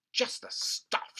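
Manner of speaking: slow, whispered gloat. Acted. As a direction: savour it in a sly whisper. George gloating.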